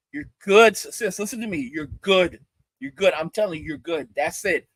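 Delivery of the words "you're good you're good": ordinary delivery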